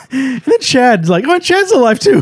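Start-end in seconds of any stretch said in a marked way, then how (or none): 1.3-2.2 very high, giggly announcement; mock amazed squeal. Host mocking the surprise survival of a character, high amazed squeal. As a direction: squeak out the unlikely news.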